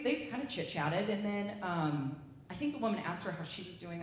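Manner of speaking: ordinary talk, nothing marked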